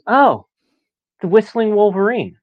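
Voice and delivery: high-pitched imitation of Dad blurting it out; sudden recollection. Dad suddenly recalls the whistle's name, high-pitched exclamation. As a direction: voice Dad's out-of-nowhere realization, pitched high.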